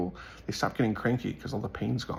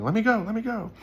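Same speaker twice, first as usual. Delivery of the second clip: high-pitched mock scream, a bit louder; imitated screaming; 12 semitones higher, 4 dB louder, faster than usual. Retelling a girl whose wrist was grabbed, imitated screaming. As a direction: act out her panicked screaming plea. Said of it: "She started screaming".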